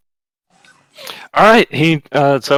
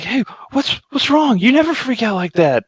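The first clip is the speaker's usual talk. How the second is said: lightly voiced kid, much higher, concerned; a child's higher-pitched worried question; 13 semitones higher than usual. Kids asking their scared friend, lightly voiced worried child.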